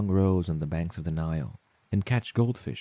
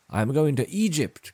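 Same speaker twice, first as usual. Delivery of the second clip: a higher, slightly raised call; swallow's cry; 8 semitones higher, 4 dB louder than usual. Acted. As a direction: lightly cry it out to nobody. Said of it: "cried the swallow".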